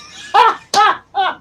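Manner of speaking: high laugh. Real, not acted. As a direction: giggle high at the joke. Laughs at teddy bear line.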